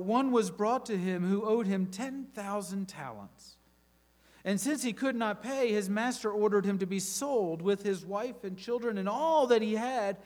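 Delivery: lively, dramatic Bible reading; scripture reading. Acted. A preacher reading Matthew to his congregation, dramatic. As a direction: read the parable like a story to the church.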